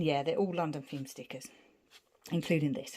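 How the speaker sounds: ordinary talk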